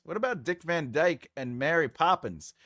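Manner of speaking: ordinary delivery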